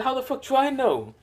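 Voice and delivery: very high-pitched mock indignation; indignant retort. Kurama answering Naruto's complaint, high indignant retort. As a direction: throw it back, voice shooting way up.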